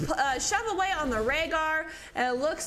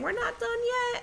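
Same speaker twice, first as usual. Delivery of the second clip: higher, voicing the team's defiance; defiant quote; 9 semitones higher than usual. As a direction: toss it off as the underdogs. Caster voices the losing team.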